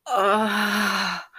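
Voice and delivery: cry of pleasure. Acted. Lover crying out as she is licked, pleasure moan. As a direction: let out a sudden cry of pleasure.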